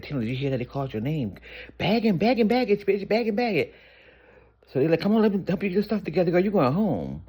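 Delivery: high, loud, fast excited shouting. Retold or imitated. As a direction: shriek the news at her. Excited girls calling her name.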